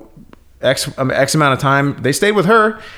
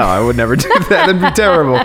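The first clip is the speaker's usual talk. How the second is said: ordinary delivery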